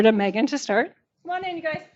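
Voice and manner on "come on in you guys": mic handed over. Real, not acted. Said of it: "So I'm gonna hand the phone, oh, microphone over".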